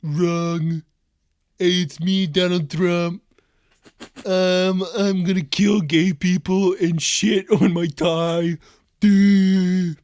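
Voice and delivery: slow, drawn-out caricature voice; Donald Trump. Acted. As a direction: stretch every phrase in a mock-politician impression. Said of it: "That was a pretty good impression".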